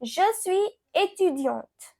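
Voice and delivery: slow, careful French model sentence; slow French demo. Shown as a demonstration. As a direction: say it slowly for learners to copy. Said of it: "I want to say".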